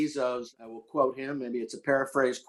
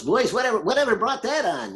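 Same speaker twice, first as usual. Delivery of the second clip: higher, louder amused coach; old coach's voice; 8 semitones higher, 4 dB louder than usual. Retold or imitated. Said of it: "that's the way he talked".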